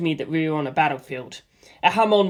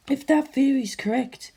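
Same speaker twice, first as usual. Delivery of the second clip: a raised, cool and thoughtful woman's voice; Lisa Lisa's voice; 8 semitones higher than usual. A narrator's reading. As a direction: voice the calm Hamon master, puzzling it out. Lisa Lisa voice continues.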